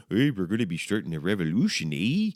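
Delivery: hammed-up Canadian accent, lifting high on the eh; chirpy-tag Canadian accent impression. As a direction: play it as a cartoon Canadian. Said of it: "in a Canadian accent".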